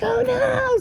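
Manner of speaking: panicked gasp on learning the dog is loose; alarmed oh no. Retold or imitated. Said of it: "And I was like".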